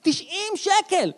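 squeaked high and slow in disbelief; the price said like it hurts; high, stretched gasp of outrage; shocked outburst. Acted.

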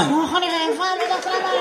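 high, soft dazed teacher; lightly voiced entranced teacher; hungry teacher. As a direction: sound entranced by the smell. Teacher craving lachuch.

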